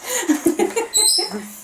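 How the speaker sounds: high-pitched giggling laugh. Real, not acted. Amused by her own mix-up.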